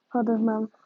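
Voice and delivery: ordinary delivery, nothing marked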